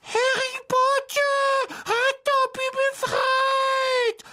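very high, slow squeal; stretched shrill creature squeak; shrill house-elf. A narrator's reading. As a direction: proclaim it in a piercing elf voice. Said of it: "sagte der Elf schrill".

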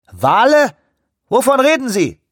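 high, loud incredulous radio voice; over walkie talkie. A narrator's reading. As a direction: snap back in disbelief over radio. Ship radio disbelief.

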